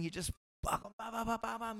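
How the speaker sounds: high rapid "ba ba ba" punch noises; rapid punch sounds ba ba. Retold or imitated. Fighter imitating his punch flurry in the ring, high rapid babble. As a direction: rattle off the punches high and fast.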